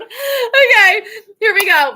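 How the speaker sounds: high, loud bright restart; bursting back into the lesson; excited. Real, not acted. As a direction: launch in with a burst of energy. Excited restart after laughing.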